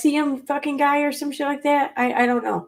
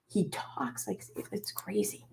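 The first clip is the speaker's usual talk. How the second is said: hushed whisper, much softer and slow; 13 dB quieter than usual. Real, not acted. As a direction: lean in and whisper it like a secret. Confiding disbelief about the lookalike.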